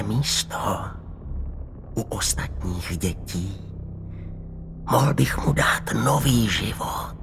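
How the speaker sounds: slow, raspy sinister whisper; rasping voice. A narrator's reading. Old man promising the nurse's son a new life, raspy whisper. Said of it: "řezavým, drsným hlasem".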